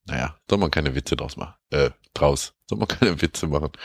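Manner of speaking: usual speaking voice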